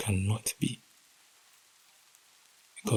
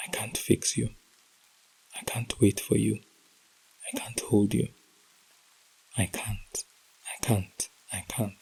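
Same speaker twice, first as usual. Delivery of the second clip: hushed whisper that gives out into silence; 3 dB quieter than usual. Acted. Heartbroken reader whispering her letter to a lost lover. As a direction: whisper each I can't, fading to nothing.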